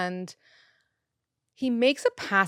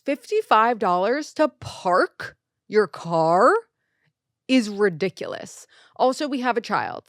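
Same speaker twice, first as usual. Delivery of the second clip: indignant outrage; 3 semitones higher, 5 dB louder than usual. Real, not acted. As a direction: lean hard into the disbelief. Outraged at parking fee.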